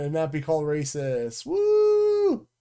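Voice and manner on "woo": loud cheer. Real, not acted. Cheering the ending.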